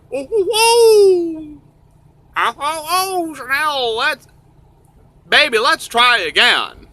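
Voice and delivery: high, drawn-out fake sobbing; mock crying. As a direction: wail and plead in falsetto. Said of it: "Chanel cries like a bitch".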